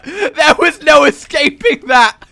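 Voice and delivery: high, loud laughing talk; laughing loudly. Real, not acted. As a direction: talk through a big laugh. Laughing hard at game mishap.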